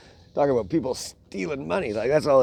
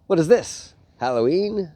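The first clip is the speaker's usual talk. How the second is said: mock surprise; 7 semitones higher, 5 dB louder than usual. Spidey mocking the villain.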